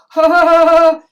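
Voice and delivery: loud, high mimicked ha-ha; mock laughing. Imitating the one onlooker who laughs, mock laughing. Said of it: "that one person who's like".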